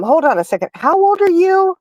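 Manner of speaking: rising high and slowing down in disbelief; a lady suddenly realising she is talking to someone she thought was a kid; surprised question. Retold or imitated. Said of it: "the lady says".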